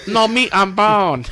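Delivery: put-on slow caricature of a Chinese accent; slow, put-on Chinese-accented buyer; mock Chinese accent. Acts out buyer rejecting scrawny cat.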